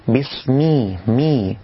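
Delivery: بسمي with a short clean i on m; clear kasra on mim. Shown as a demonstration. Teacher shows the correct mim. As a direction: close بسمي with a crisp kasra. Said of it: "تحقيق الكسر في الميم".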